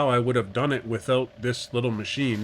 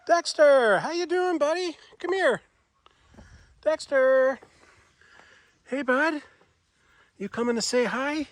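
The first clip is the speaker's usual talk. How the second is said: pet talk voice; 12 semitones higher, 3 dB louder than usual. Real, not acted. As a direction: coo up high at your pet. Calling and greeting the barn cat.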